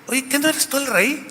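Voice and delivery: high, soft, lightly voiced scheming wife; Jezabel's taunt. Wife goads the king about his power, in a sly high voice. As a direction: needle him with a sly question.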